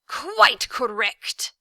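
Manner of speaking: slow, loud gloating shriek; the villain shrieks in victory; triumphant shriek. A narrator's reading. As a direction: crow it out in triumph. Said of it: "he shrieked triumphantly".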